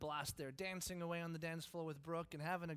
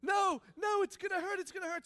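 put-on high, loud, frantic child's protest; panicked refusal; 10 dB louder than usual. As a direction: cry out against it in terror. Child interrupts dad in panic.

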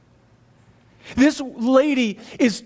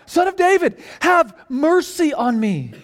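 crying out more insistently; 6 semitones higher than usual. A narrator's reading. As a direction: call out desperately, refusing to be silenced. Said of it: "he cried out all the more".

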